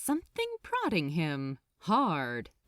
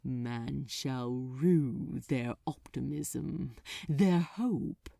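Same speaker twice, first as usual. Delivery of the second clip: contempt; 6 semitones lower, slower than usual. Acted. Demon mocking human hope.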